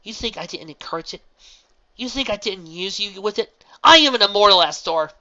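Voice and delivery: loud, rising angry voice; angry outburst. A narrator's reading. Celestia confronts Astor, angry and loud. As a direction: hurl it at her in fury.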